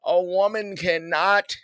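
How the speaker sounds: hammed-up imitation of a YouTube dating guru; mock red-pill YouTuber. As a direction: lay down a red-pill guy's rules in mockery. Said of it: "I'm so tired of these dudes on YouTube".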